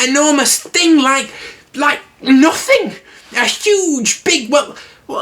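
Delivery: very high flustered squeak, groping for words; a panicked little pig trying to describe a monster; squeaky Piglet voice. Acted. Piglet describing the heffalump to Christopher Robin, very high flustered squeak. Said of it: "doing that Piglet voice screaming".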